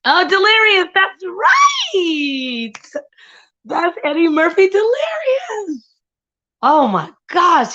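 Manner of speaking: thrilled, high and loud; excited shout. Real, not acted. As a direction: shout it out in delight. Excited recognizing the comedy special.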